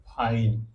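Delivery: फाइन said low and slow, with a long "aai" sound; teacher demo. Shown as a demonstration. Teacher says फाइन slowly so children hear it. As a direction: model the word फाइन clearly for the class. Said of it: "ये हमारा क्या हो जाएगा?".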